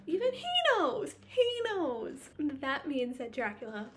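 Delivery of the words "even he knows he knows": much higher, louder disbelief; stressing that even Renfield understands; incredulous outburst. Real, not acted. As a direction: cry it out in disbelief. Incredulous emphasis.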